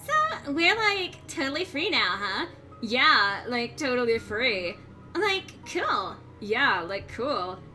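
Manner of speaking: put-on bubbly valley girl voice, lots of like; Valley Girl accent. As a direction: play it like a bored mall girl. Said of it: "Valley Girl accent".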